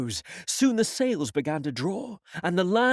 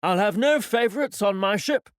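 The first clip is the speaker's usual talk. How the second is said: raised, pointed captain's remark; a stern captain making a point aloud; loudly; 5 semitones higher, 5 dB louder than usual. Acted. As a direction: say it loud enough to be overheard. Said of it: "quite loudly to the doctor".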